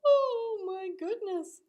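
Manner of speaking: high, loud, slow astonishment; wide-eyed awe, high and loud; amazed gasp. Retold or imitated. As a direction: marvel at the calm. Friends amazed by miracle.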